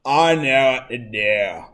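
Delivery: a hint of a stubborn student, said slowly; imitating a student. Teacher imitating a student who resists the extra step, lightly voiced student. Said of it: "Some of you would go".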